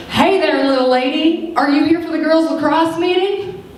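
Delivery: booming coach voice. Retold or imitated. Coach greets the girl at the football meeting, mock booming voice. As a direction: hail the girl like a hearty coach, high and bright. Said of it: "this big booming voice".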